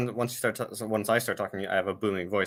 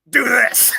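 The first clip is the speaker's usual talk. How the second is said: high, loud bossy order; loud command; 11 semitones higher, 10 dB louder than usual. Acted. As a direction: bark it like a drill sergeant. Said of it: "the masculine D E coming out".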